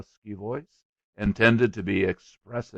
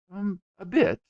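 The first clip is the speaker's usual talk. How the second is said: light; 10 semitones higher, 6 dB louder than usual. A narrator's reading. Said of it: "he confessed lightly".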